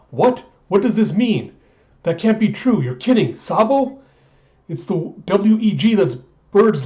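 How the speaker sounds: loud, high, protesting disbelief; shocked disbelief. Acted. Revolutionary refusing the news of Sabo's death, loud high disbelief.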